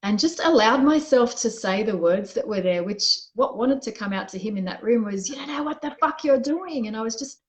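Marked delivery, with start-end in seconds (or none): 5.3-6.8 higher-pitched mock scream, not at full volume; retold angry outburst. Venting words meant for partner in the bedroom, mock scream. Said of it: "I was just screaming these words out".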